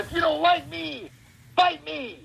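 high, strained wrestler challenge; Steiner brother. Acted. Answering a Steiner fan with the wrestler's challenge, high and strained. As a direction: push the voice high until it frays. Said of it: "just totally fries your voice".

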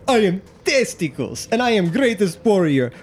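boastful warrior, high and theatrical; hammed-up conceited contestant voice; Testicles. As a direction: brag in a put-on foreign-sounding accent. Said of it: "you hear him go".

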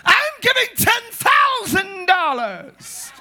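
hammed-up drawn-out show-off announcement. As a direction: proclaim it so everybody hears. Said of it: "They showing off".

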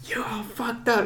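drawn-out, held-back scream; retold yell. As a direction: imitate her yelling without the volume. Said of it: "heard that lady yell so loud".

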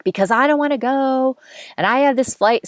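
high-pitched, exasperated protest; frustrated outburst. Retold or imitated. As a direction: blurt it out, fed up and cornered. Arguing with date, drunk and annoyed.

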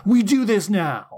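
furious goddess yelling at her minions. Retold or imitated. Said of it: "grabs Dawn by the throat and yells".

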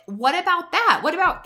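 emphatic challenge. Real, not acted. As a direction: throw the question out boldly.

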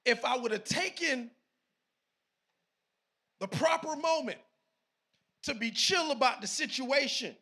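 loud, emphatic preacher; forceful sermon delivery; preaching. Real, not acted.

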